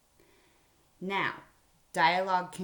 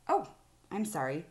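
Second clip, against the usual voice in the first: ordinary delivery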